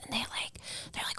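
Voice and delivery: hushed hunter whisper. Retold or imitated. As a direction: hush it like a hunting show.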